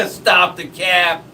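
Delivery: high, loud, drawn-out mock outcry; mock-outraged slang call; playful shout. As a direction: holler it slowly through the fun. Playful slang outburst amid laughter.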